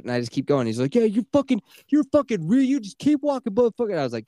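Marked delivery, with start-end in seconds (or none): 0.9-3.9 high, sneering mock of a riled-up guy; mocking the angry driver. Imitating the Subaru driver taunting him, high mock sneer. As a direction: ham up his taunt, pitched way up.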